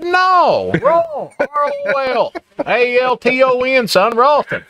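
patiently spelling the road name. Retold or imitated. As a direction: spell it out high and emphatic. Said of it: "And I said".

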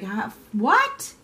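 high, loud "are you kidding me"; mock disbelief. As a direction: play a shocked, scoffing reaction. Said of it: "and you're like".

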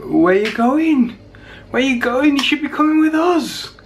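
high, slow pet voice for the dog; voicing the dog. Vlogger voicing his dog Shep's thoughts, in a high pleading voice. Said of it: "as if to kinda go, like".

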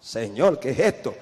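dropped way down into a low, dark growl of disbelief; low possessed rumble; deep demon voice. Retold or imitated. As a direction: sound like something not human is speaking. Her stunned reply in the fight.

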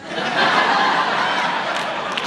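long breathy laugh; audience laughter. Real, not acted. Laughing at the Texan's geography guess. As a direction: laugh it off, wheezing.

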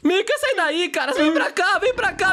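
high, frantic cries of alarm; a boy shrieks in high panic as the haunted house starts moving; panicked yelling. Acted. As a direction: scream for your friend to run as the house comes alive.

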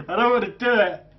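laughing while pleading; laughing reluctance. Real, not acted. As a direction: giggle through the refusal. Laughing dread of the shot.

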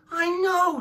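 high, loud, delighted squeal of a reply; cheerful little lamb. Acted. Wilbur the lamb answering his parents, high and gleeful. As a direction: chirp it like a proud young sheep.

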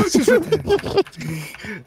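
laughing. Real, not acted. Laughs while repeating friend.

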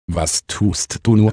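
ordinary delivery

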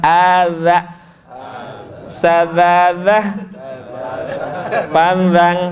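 "Adza. Sadzadzah. Panzang" with slow buzzing dh; dza practice drill. Shown as a demonstration. Teacher setting home practice, slow buzzed dh. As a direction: draw out each word, voicing every dh. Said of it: "latihan di rumah ntar".

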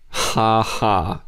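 dry, mocking ha ha; a flat sarcastic laugh-word. A narrator's reading. Kay mocks Osax's compliment, sarcastic ha ha. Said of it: "she said sarcastically".

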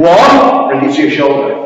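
ordinary talk

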